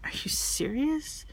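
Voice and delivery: airy, slow, rising disbelief; incredulous question. Retold or imitated. Madeline refusing a ride from the drinking father, incredulous. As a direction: imitate someone appalled, pitch climbing on "serious".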